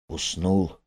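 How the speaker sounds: hushed near-whisper, not to wake the bear; softly, so the sleeping bear won't hear. A narrator's reading. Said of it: "говорю я шёпотом".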